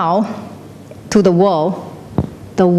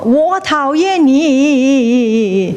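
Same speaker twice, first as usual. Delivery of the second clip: high, long echo with a wavering tail; 5 semitones higher, much slower than usual. Acted. The cliff throws the dislike back, long echo. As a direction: stretch the last word into ripples. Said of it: "It will go".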